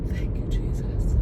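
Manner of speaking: voiceless, tearful whisper; whispered thanks. Real, not acted. Driver thanking Jesus for the rainbow, tearful whisper. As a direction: whisper a prayer of thanks through tears.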